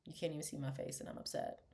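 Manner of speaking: low, sulky murmur behind a veil; pouty upset mourner. Pretend. Mock mourner under a veil, sulky. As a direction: sulk quietly, hidden under a veil. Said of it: "giving face".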